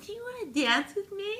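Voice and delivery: put-on sing-song it like a giddy teen; playful high voice. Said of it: "I'm like".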